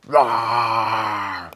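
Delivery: loud monster roar; Hulk roar. Pretend. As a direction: roar like a raging brute. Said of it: "I think I'd be a pretty good Hulk".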